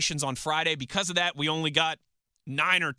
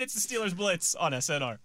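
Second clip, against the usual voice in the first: usual speaking voice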